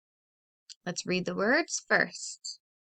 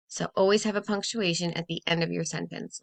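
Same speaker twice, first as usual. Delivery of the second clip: ordinary delivery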